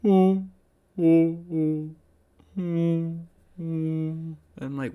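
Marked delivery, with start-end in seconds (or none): 0.0-3.2 high, sustained spooky "oh"; imitated eerie speaker noise. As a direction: mimic a ghostly recorded moan, pitched up. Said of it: "and I hear".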